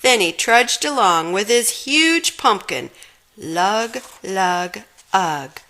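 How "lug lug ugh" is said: usual speaking voice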